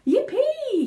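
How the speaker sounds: high, loud joyful whoop; an old man's victory yell; shouted yippee. Acted. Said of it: "he shouted".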